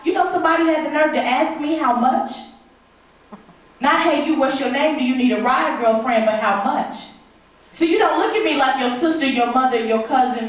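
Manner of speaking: ordinary talk, nothing marked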